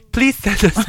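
ordinary delivery

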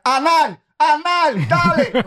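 high-pitched shouted call; yelling. Retold or imitated. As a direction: yell it out into the mic. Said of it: "Tomás comenzó a vocear".

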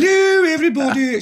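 posh English drama queen, high and loud; Honey. Acted. Actress dog greeting everybody, grand posh English. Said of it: "She talked like that".

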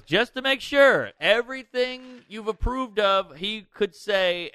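high, slow, emphatic checking; careful manager. Retold or imitated. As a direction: spell it out deliberately for the record. Manager quoted pinning down approval.

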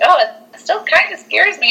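high-pitched reenacted fright; mock scared. Viewer rewatching a scary film, high mock fright. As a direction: squeal it as a half-joking admission of fear. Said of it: "I was always really afraid".

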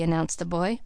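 usual speaking voice

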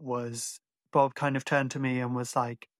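ordinary talk, nothing marked

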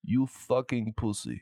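slow put-on Russian accent; Russian-accented mock insult. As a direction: taunt in a drawn-out Russian accent. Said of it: "We did a Russian accent earlier".

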